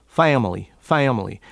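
family with a nasal Southern A; Appalachian family, nasal vowel. Shown as a demonstration. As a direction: push the A of family through the nose. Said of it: "you are hearing a more nasal quality".

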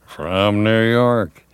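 drawn-out station announcer delivery; big, slow on-air announcement. Shown as a demonstration. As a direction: stretch it out slowly like a show opening. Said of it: "using your announcerly talents".

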